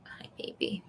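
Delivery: soft, slow unvoiced whisper; cooing whisper. Real, not acted. Talking to her cat Nuggies, slow whispered coo.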